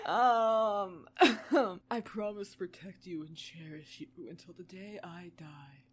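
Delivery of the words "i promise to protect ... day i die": deep husky whisper. Acted. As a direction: breathe it out like a seducer. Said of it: "his voice sounding deeper and huskier".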